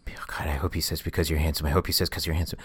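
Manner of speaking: secretly hoping in a whisper; whispered thoughts. Pretend. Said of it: "without the thoughts".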